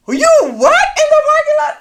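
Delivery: very high, loud disbelief; incredulous. Retold or imitated. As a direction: shriek in disbelief. Disbelieving wife hears he fell.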